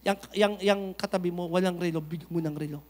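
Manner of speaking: soft, low, hushed voice of the Lord; voice of God speaking. Retold or imitated. Preacher voicing God nudging a believer, hushed and soft. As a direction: whisper it gently like God calling his child. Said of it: "Nangungusap yan, tulad ng".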